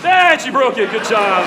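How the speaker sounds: high, loud delighted praise; excited cheer. Real, not acted. Cheering Hannah after she broke it, loud and excited. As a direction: burst out cheering at her success. Volunteer breaks the stick.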